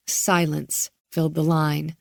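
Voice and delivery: plain reading voice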